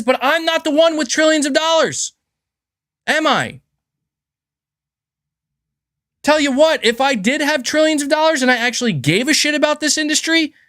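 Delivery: worked-up, high-pitched indignant outburst; exasperated rant. Real, not acted. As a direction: let them have it, high and forceful, hitting each demand. Fired-up rant at Microsoft.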